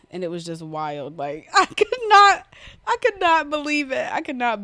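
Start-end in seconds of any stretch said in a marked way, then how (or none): 1.2-4.6 higher, louder laughing through words; laughing disbelief. Real, not acted. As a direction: laugh while saying you can't believe it. Laughing disbelief, measures high.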